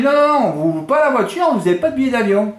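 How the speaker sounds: high, insistent clerk imitation; mock refusal. Europcar clerk turning away a local woman, mock refusal.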